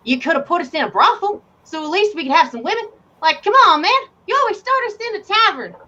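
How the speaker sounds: high, whiny grumble; whiny complaint. Acted. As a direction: complain like a bored player. Player complaining about tavern start.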